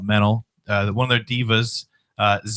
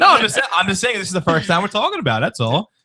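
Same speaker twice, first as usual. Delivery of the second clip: laughing; 8 semitones higher than usual. Real, not acted. Laughing defence after teasing.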